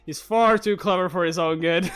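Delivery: normal talking voice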